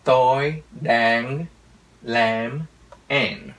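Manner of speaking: put-on slow American accent, every final consonant drawn out; American-accented Vietnamese. Teacher imitates an American speaking Vietnamese, slow American accent. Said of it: "người Mỹ nói nó nghe như thế này".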